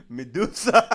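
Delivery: bursting out laughing on the name; loud laugh-out reading; cracking up loudly as it's read. Real, not acted. Reading a funny comment aloud.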